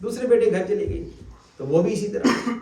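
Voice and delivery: ordinary talk, nothing marked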